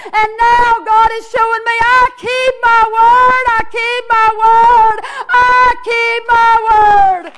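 high, loud preacher's shout, building; full-force revival shouting; thunderous proclamation. Retold or imitated. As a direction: cry the promise out, stretching each repeat. God's promise preached at full force.

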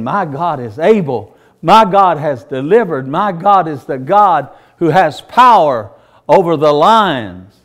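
put-on loud, emphatic old prophet testifying; ringing declaration. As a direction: proclaim it forcefully, hitting the key words hard. Acted old man declaring his faith.

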